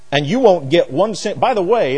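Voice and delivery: ordinary talk, nothing marked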